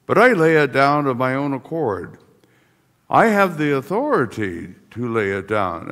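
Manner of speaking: usual speaking voice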